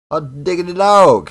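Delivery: over-the-top American twang, high and loud; a hammed-up Yankee exclamation; bad American accent. Hobbyist gluing an antenna, cheering in a bad American accent. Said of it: "that was a very bad, very, very bad attempt at an accent".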